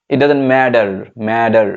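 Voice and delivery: 'it doesn't matter' with an r at the end; American pronunciation. Shown as a demonstration. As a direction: tap the t to a d in 'matter'. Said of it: "American বলে".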